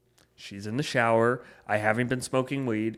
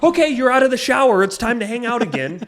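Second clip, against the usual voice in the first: squeaky, much higher and louder mock impression; mocking girlfriend voice; 12 semitones higher, 9 dB louder than usual. Mimicking his girlfriend waiting at the bathroom door, mocking. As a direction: mimic her clingy eagerness with a sneer. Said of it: "fuming mad".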